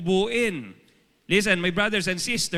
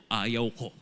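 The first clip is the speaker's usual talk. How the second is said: sulky refusal; 10 semitones lower, 3 dB quieter than usual. Retold or imitated. Stubborn inner voice refusing.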